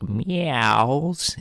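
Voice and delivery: drawn-out, rising and gliding meow; cat-like meow. A narrator's reading. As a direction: stretch the word like a cat's cry. Narrator imitates cat's meow.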